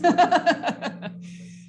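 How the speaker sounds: a quick amused laugh; short laugh. Real, not acted. Instructor laughing at a bad email example, a quick bright laugh.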